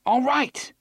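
high, loud giving-in; cried out. Acted. Eddie giving in to Roland, a loud high cry. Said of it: "Eddie cried".

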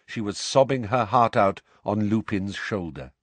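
ordinary delivery

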